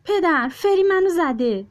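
put-on high, loud child cry for father; child yelling to dad; shouting. A narrator's reading. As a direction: call out to dad, upset and loud. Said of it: "زیزی داد زد".

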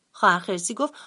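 ordinary delivery

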